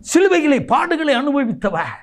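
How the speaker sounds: high, fervent proclamation; impassioned preaching. Real, not acted. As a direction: raise the pitch sharply and preach it. Phrase repeated with preaching force.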